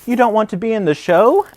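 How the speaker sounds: can't believe their friend won't join; surprised question. Acted. Friends asking Bear, surprised, quick and high.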